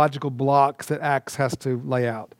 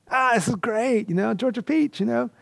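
higher, delighted recognition; excited; 7 semitones higher than usual. Retold or imitated. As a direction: greet a fellow Georgian with glee. Meeting a fellow Georgian abroad.

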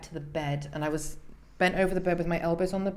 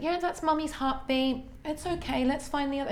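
higher sing-song midwife voice; sing-songy; 8 semitones higher than usual. Retold or imitated. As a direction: sing it out like soothing a child. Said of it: "she was very sing-songy".

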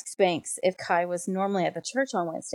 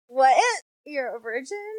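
acted shock; 5 semitones higher than usual. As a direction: play mild disbelief, pitch up. Acts out his shocked reaction.

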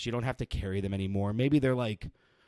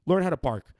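annoyed; 6 semitones higher, 5 dB louder than usual. Real, not acted. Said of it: "I'm getting pissed off".

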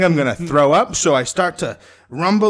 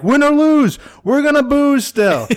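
crew-bar cheer; 9 semitones higher, 5 dB louder than usual. Retold or imitated.

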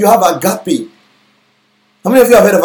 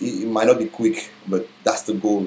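usual speaking voice

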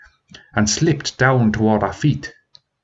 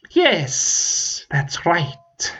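hissing dragon; 7 semitones higher, much slower than usual. Acted. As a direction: hiss the agreement. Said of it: "the dragon hissed".

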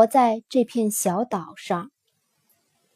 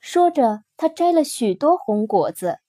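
plain reading voice

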